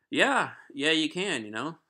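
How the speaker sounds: high, loud, over-agreeable bluff; emphatic fake agreement, imitated; playing it off. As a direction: pretend you understood perfectly. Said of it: "playing it off like I understood".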